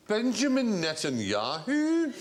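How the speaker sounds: Connolly-style riff on the name; imitating Billy Connolly on the phone; slow drawn-out Netanyahu. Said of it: "He just went".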